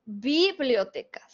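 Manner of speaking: "Bibliotecas" with the first syllable BI wrongly stressed. Shown as a demonstration. Teacher showing how odd it sounds, stress on bi. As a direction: slowly stretch bi in "Bibliotecas", let the rest fall. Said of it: "Suena rarísimo".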